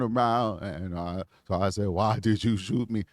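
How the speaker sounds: reenacting a traumatized man, low and hushed; imitated trembling voice. Reenacting the shot-at man's account to show the trauma, imitated trembling voice. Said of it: "You heard that trembling in that man's voice?".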